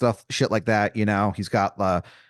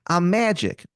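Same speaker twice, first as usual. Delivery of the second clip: higher-pitched, hammed-up Maryland accent; Wizard of Baltimore, bad Maryland accent; 6 semitones higher than usual. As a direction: put on a terrible Maryland twang. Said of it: "this man's accent is something".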